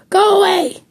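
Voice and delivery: high parrot squawk, pitched well up. A narrator's reading. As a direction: screech it like a cranky parrot. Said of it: "the parrot sitting on the back of her chair called out".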